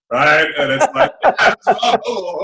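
laughing. Real, not acted. Laughing at parents' expectations.

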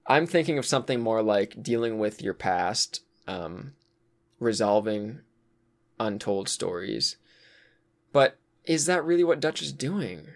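usual speaking voice

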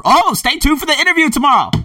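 high, loud, sudden excitement; excited afterthought. Real, not acted. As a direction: burst back in with the news.

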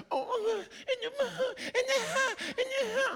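mock a whining complainer; mock whiny complaint. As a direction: churchgoer whining about family. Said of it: "whenever someone's like".

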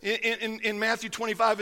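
usual speaking voice

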